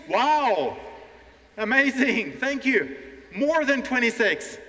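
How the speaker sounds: high, loud cheer for the class; excited praise. Real, not acted. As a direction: cheer the winning count like a game show host. Praising contest answers to the class.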